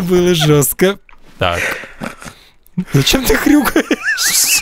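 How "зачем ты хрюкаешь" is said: slow, high, laughing question; laughing through words. Real, not acted. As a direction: giggle while asking. Смеётся над хрюканьем друга. (сквозь смех)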